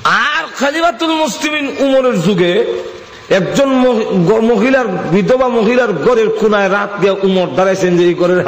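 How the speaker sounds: high sermon chant, gradually descending; preaching. Acted. Preacher opening the story of Caliph Umar, high preaching voice.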